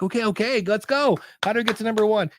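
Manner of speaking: mock eager, pitched well up; impatient eagerness. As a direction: hurry them along, hammed-up. Impatient listener waiting.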